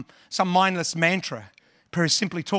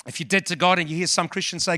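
usual speaking voice

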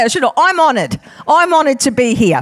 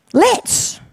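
bright reveal; 7 semitones higher than usual. Acted. Revealing the word.

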